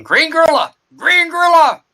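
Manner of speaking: high, loud monster roar; shouting. Acted. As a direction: bellow the name at the sky. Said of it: "and shouting".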